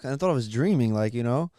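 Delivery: ordinary delivery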